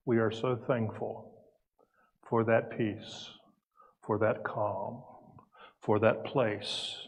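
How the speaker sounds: calm prayer with long, unhurried pauses; guided meditation. Real, not acted. Minister leading the congregation into peace, calm meditative prayer. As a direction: lead them gently into stillness, pausing between phrases.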